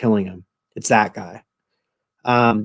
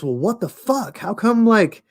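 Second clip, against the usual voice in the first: incredulous; 7 semitones higher than usual. Retold or imitated. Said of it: "And he goes".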